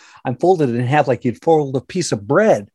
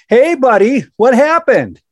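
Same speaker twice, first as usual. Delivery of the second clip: a hint of a cheery, much higher, louder paramedic; lightly voiced friendly rescuer, upbeat; 12 semitones higher, 7 dB louder than usual. Firemen greeting the fallen man, lightly voiced friendly call. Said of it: "They're all friendly".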